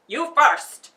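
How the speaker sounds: loud barking guard; barked order. A narrator's reading. Soldier on guard barks at Dorothy, loud. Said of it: "he barked".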